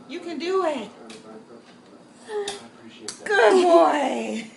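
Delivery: pet talk. Real, not acted. Coaxing the pet to fetch.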